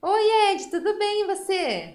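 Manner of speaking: loud, high cheerful hello; excited greeting. Acted. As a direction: greet him with big energy. Greeting puppet, bright and loud.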